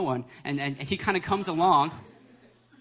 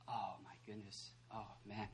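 dismayed teacher; 9 semitones lower, 22 dB quieter than usual. Acted. Preacher as the teacher losing hope, hushed and dismayed. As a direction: sink into quiet dismay. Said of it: "So this teacher's like".

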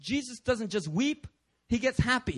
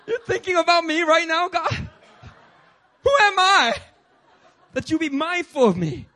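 loud, high-pitched mock disbelief; overwhelmed amazement; 7 semitones higher, 9 dB louder than usual. Believer stunned that God thinks of them, overwhelmed amazement. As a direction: cry it out, stretching the question.

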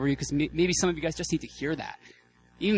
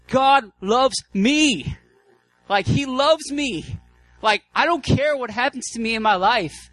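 much higher, louder, slowed ecstatic declaration; ecstatic outcry; 8 semitones higher, 7 dB louder, slower than usual. Retold or imitated. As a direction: cry it out as if overwhelmed by God's love. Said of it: "You're like".

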